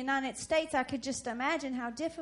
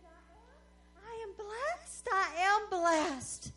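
weeping woman; 6 semitones higher, 4 dB quieter, slower than usual. Retold or imitated. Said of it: "began to weep and cry so hard".

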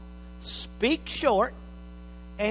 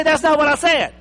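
indignant wife, loud and higher; mock wife; 8 semitones higher, 10 dB louder than usual. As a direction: play the offended wife. Acted wife in marital quarrel.

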